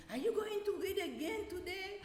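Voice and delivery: put-on high, childish pleading voice; imitating eager kids. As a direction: pitch up like a child begging. Children asking eagerly for stories.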